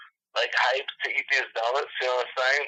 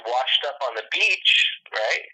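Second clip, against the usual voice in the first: usual speaking voice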